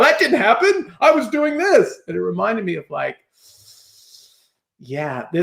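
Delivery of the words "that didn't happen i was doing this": higher defensive mock protest; playful retold denial. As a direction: insist you did nothing wrong. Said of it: "like, in denial".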